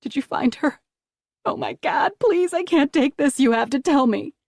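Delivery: pitched very high, pleading in panic; desperate pleading. A narrator's reading. Mother begging the agent for news, frantic. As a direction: beg for news, near breaking.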